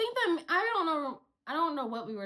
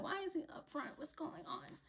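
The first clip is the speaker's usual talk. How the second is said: very soft, higher-pitched wondering; puzzled hushed question; 5 semitones higher, 15 dB quieter than usual. Retold or imitated. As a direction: whisper it as a bewildered aside. Puzzled inner question, nearly whispered.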